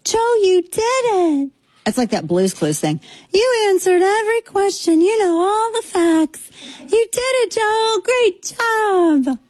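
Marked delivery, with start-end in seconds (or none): 0.0-1.5 much higher, slow, sing-song praise; talking to a toddler. Retold or imitated. Host mimics wife praising husband like a toddler. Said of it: "She talks to him like he's three". 3.3-9.4 much higher, slow, sing-song praise; talking to a toddler. Retold or imitated. Host mimics wife praising husband like a toddler. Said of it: "She talks to him like he's three".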